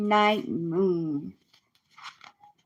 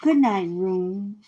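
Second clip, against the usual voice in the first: bedtime reading. Acted. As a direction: read it like a bedtime story. Reads bedtime book.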